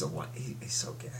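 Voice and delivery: voiceless whisper, half-joking; whispered aside. Real, not acted. Telling a friend in a joking whisper. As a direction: hiss it out as a secret aside.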